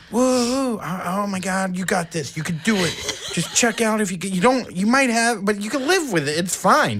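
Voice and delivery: psyching himself up, voice lifted; mock brave self-encouragement; self pep talk. Self pep talk while walking.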